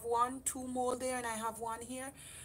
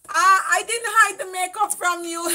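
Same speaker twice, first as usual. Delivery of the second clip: high, loud mock-indignant denial; playful loud protest; 6 semitones higher, 11 dB louder than usual. Real, not acted. Denying to her sister that she hid the makeup, playful loud protest. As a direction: protest loudly, ready to laugh.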